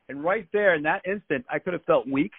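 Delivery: ordinary delivery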